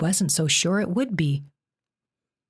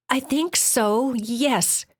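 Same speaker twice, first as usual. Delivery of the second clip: put-on higher, slow frightened girl; Jen's voice; 7 semitones higher, much slower than usual. A narrator's reading. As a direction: answer timidly in a girl's voice. Jen answering timidly.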